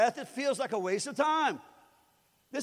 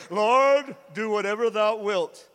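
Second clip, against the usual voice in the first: louder, hammed-up holy tone; mock pious prayer; 6 dB louder, slower than usual. Pastor mocking a ridiculous prayer, louder. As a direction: overdo the old-fashioned plea. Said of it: "ridiculous prayer".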